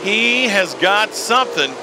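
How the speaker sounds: rising excitement as a driver charges; motorsport commentator. Acted. Live race play-by-play call.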